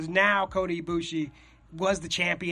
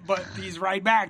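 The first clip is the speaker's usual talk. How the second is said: normal talking voice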